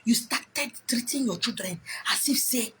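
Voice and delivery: slow hushed whisper. Acted. Speaker mocking how believers act, in a whisper. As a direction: breathe it out slowly and secretly.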